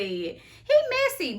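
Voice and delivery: high swoop on "messy"; quoting Nene Leakes. Retold or imitated. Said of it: "Like Nene Leakes once said".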